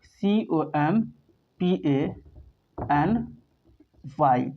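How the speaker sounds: very slow, each letter of सीओएमपीएएनवाई spelled out. Shown as a demonstration. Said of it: "चीज़ आप लोग दिखा रहे हैं". (अक्षर-अक्षर धीरे-धीरे बोलना)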